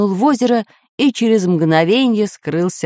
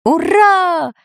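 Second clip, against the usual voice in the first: loud, higher cry of relief; joyful shout; 9 semitones higher, 3 dB louder than usual. A narrator's reading. Professor realizes they are saved, loud joyful shout. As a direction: burst out with a sudden hooray. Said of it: "неожиданно закричал профессор Пыхтелкин".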